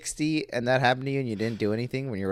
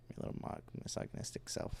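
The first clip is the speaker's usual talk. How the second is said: whispering; 16 dB quieter, faster than usual. Acted. Teasing the co-host.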